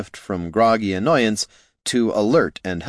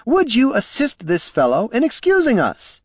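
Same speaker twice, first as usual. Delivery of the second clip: high, raised voice, insistently polite; pitched-up imperious request; cutting someone off; 3 dB louder than usual. A narrator's reading. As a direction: call over him with strained politeness. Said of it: "but Flynn cut him off".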